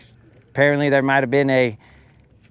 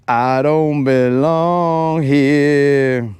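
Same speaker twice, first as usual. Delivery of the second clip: slow, drawn-out, louder fish whine; 5 dB louder, much slower than usual. Pretend. Bullied lure fish complaining it doesn't belong, drawn out. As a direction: stretch it out like a lost fish.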